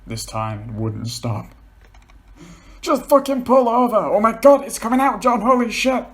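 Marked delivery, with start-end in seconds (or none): 2.8-6.0 panicked. Acted. Paul panicking as he wets.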